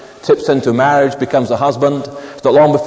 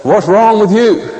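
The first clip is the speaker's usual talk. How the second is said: gruff husband; 8 semitones higher, 5 dB louder, slower than usual. Retold or imitated. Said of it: "You know, Mister Sensitive".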